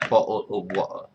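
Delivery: British "Bottle of water" with the T's completely omitted; mock-British glottal-stop "Bottle of water"; British accent. Shown as a demonstration. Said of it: "completely omit the T's".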